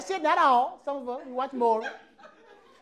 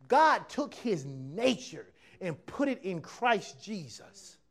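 usual speaking voice

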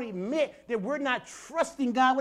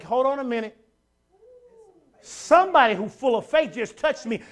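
Jesus stopping; 4 semitones higher, 7 dB louder than usual. Retold or imitated. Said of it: "he was like".

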